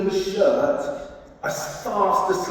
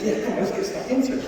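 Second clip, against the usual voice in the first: fast, higher, eager push; eager urging; 9 semitones higher, much faster than usual. Retold or imitated. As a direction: egg them on quickly. Tindall urging, quick retort.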